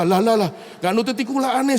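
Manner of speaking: father fed up with his son's haggling; exasperated father. Retold or imitated. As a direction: throw up your hands in disbelief.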